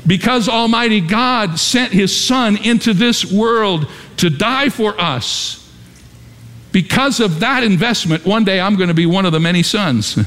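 high-pitched, emphatic pulpit delivery; fervent preaching. Acted. Preacher telling congregation of God's investment, fervent preaching.

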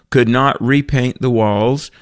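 usual speaking voice

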